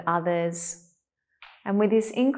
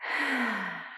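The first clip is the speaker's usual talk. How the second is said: soft open-mouthed exhale; sighing breath out; 3 semitones higher, 4 dB quieter than usual. Shown as a demonstration. Teacher taking one more breath with the class, soft sigh. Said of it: "take one more of those breaths".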